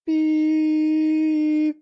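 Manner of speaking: long, high, steady beep; sustained mock machine beep; imitated beep. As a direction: hold a high electronic-style tone. Pretending to be a bubble.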